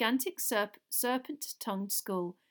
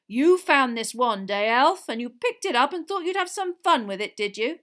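menacing accusation; 5 semitones higher, 11 dB louder than usual. A narrator's reading. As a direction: bear down on the culprit. Diggory rounds on the elf.

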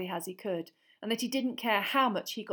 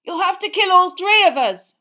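high, very loud challenge; defiant shout; 11 semitones higher, 16 dB louder than usual. A narrator's reading. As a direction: shout it as a last stand. Ron defies the killer.

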